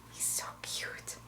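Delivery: soft adoring whisper. Real, not acted. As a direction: whisper it lovingly. Cooing over toy dog.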